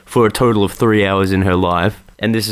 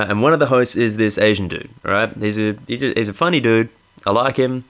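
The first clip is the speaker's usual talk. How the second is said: ordinary delivery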